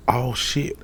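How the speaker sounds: surprised. Retold or imitated. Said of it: "out loud".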